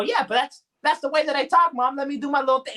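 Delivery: high-pitched, defensive retort to a parent; mock-indignant whine; exasperated protest. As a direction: plead your case to mom. Acted protest to correcting mother.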